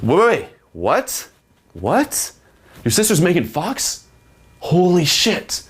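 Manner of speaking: high, slow, amazed; hook-point excitement, voice up; excited. Shown as a demonstration. Man stunned his sister is Megan Fox, amazed. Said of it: "tonality is gonna go to".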